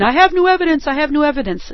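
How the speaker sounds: high, breathless mock announcement; mock movie drama. Hammed-up movie witness bursting into court, high and excited. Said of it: "old movies where somebody comes running in".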